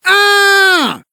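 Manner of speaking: fleeing scream. Acted. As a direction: keep screaming in panic. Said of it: "he continued to scream".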